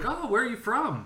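mock ditzy blonde asking where he's from; imitating a bubbly girl. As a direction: chirp it like a ditzy stranger on a bus. Voicing the crazy blonde chick.

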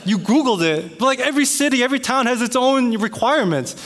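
high-pitched, indignant arguing; exasperated disbelief. Retold or imitated. Homeowner arguing with neighbor over fence rules, indignant. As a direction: protest as if the claim is absurd.

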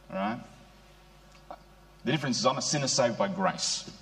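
ordinary delivery

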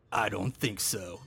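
low, slow, soft tough-guy voice; cool cowboy line. Retold or imitated. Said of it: "he just says to himself".

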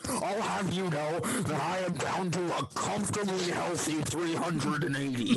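hammed-up indignant teacher voice; offended teacher. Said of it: "The teacher's like".

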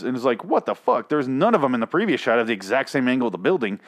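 usual speaking voice

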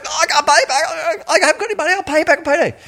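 high, fast, panicked pleading; pleading debtor. Retold or imitated. Debtor begging the creditor, high rushed pleading. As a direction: gabble excuses in a high voice. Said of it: "And the guy's going".

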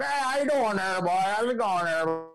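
high, twangy small-town greeting. Retold or imitated. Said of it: "like, real redneck".

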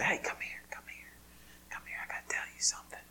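soft, breathy secret whisper. Retold or imitated. Father coaxing his daughter close with a whispered secret. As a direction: draw her close with a secret. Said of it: "when I begin to whisper".

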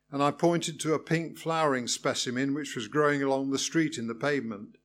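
usual speaking voice

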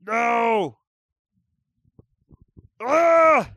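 loud high "No! Ah!" of frustration; real exasperated shout; frustrated yell. Real, not acted. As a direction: cry out in real annoyance. Frustrated, can't remember the line.

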